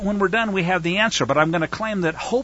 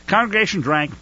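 ordinary delivery

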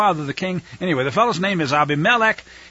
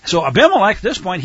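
ordinary delivery

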